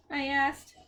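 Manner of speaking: ordinary delivery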